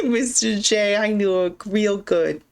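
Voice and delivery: slow, hammed-up New York accent; mock New York accent. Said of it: "New York accent".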